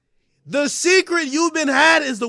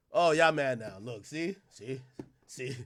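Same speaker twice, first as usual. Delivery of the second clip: quiet taunt; 11 semitones lower, 11 dB quieter than usual. Real, not acted. Taunting viewers, dropping quiet.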